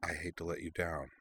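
soft, half-whispered regret; almost whisper it; near whisper. A narrator's reading. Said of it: "added in a near whisper".

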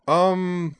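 loud, high, drawn-out filler; called-out hesitation. A narrator's reading. Azalin works out a date mid-shout, calling out. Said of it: "did a bit of mental calculation".

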